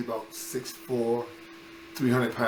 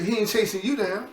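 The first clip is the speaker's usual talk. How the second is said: much higher, protesting; exasperated kid; 9 semitones higher than usual. Retold or imitated. Boy insisting to his friend that the coach is after him, mock exasperated. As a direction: complain it like a hunted kid.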